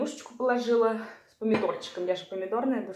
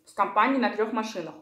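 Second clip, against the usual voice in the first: talking between bites; chewing food while talking; faster than usual. Real, not acted. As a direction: mouth partly full. Seen 2: Takes spoonful at 6.3, then talks while chewing with mouth full.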